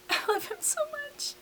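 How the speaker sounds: tiny, very high and soft lovestruck squeak; swooning squeal. Acted. Hamilton gushes to John in a high swoon. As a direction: squeal it like a smitten teenager. Hamilton's love letter, repeated.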